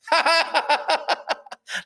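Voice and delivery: high, far-flung burst of laughter; laughing. Real, not acted. Host tickled by the Chateau joke, high laughter. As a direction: crack up at your own joke.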